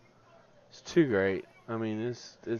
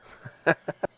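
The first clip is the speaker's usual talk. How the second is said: short burst of real laughter; delighted laugh; 5 dB louder than usual. Real, not acted. Streamer laughing at the flying fish. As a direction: laugh at the silly sight.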